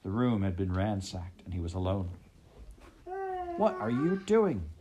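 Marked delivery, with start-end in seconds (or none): 3.6-4.7 high, slow, demanding question. Acted. Heather confronting the traitor, demanding. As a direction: press him hard for an answer.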